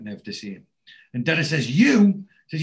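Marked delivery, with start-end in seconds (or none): 1.7-2.2 high, loud, scoffing question; incredulous retort. Retold or imitated. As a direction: throw the word back in disbelief. Dennis's scornful reply to Neville.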